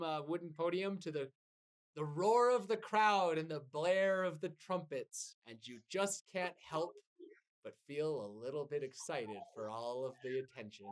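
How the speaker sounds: ordinary talk, nothing marked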